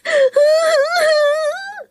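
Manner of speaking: high, drawn-out boo-hoo crying; wailing sobs. A narrator's reading. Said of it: "she sobbed".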